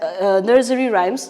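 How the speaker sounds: usual speaking voice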